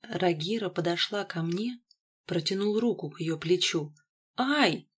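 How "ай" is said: high, short "ow"; startled high exclamation of pain; pained yelp. A narrator's reading. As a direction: yelp as if zapped. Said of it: "выдохнула девушка".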